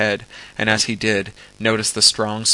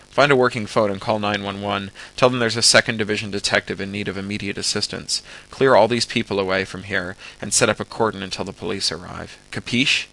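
ordinary delivery, nothing marked

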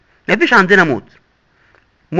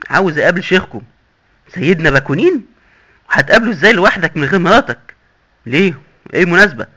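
usual speaking voice

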